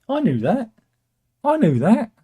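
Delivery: high, swooping insistence; mock defensive. Reader who just looked up OBR, mock defensive. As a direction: protest loudly in a high mock-knowing voice. Pretends he knew the answer.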